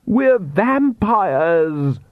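high, drawn-out indignant cry; shouting. A narrator's reading. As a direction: stretch it out in an exasperated shout. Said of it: "Lacrimosa shouted".

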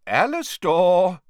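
loud, high, drawn-out warning. A narrator's reading. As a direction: call the name out slowly as a warning. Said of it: "said Dumbledore warningly".

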